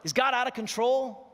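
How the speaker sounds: rally the congregation for an answer; calling out to the crowd. Acted. Preacher calling out to the church, loud rallying question.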